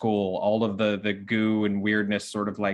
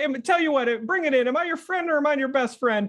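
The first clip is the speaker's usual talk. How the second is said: very high-pitched, chummy character voice; high, needy little character; eager Wardy; faster than usual. Acted. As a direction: babble in a high voice, eager for a hug. Plays Wardy, eager for a hug.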